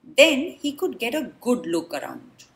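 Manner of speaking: usual speaking voice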